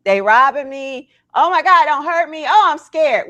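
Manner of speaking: high, loud, hammed-up cries of fake fear; mock scared yell. Coaching viewers to fake fear for police, hammed-up yell. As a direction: yell it like a pretend robbery victim begging for help. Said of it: "Sometimes you have to yell".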